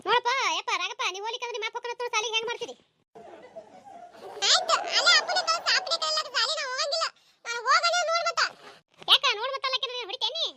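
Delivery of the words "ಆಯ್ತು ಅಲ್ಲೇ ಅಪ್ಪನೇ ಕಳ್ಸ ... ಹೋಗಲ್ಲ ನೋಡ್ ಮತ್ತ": put-on higher child's voice refusing; little boy refusing school; sulky child. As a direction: whine it like a stubborn kid.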